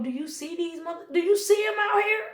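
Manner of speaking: climbing to a high, loud exclaim; incredulous outburst. Real, not acted. Reader venting to viewers about kids, incredulous high outburst. Exasperated about kids' emotions.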